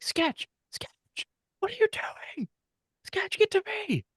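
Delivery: squeaky-high held-back panic; Jinxie losing his shit. Retold or imitated. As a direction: imitate a frantic coach calling out. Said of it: "like, losing his shit".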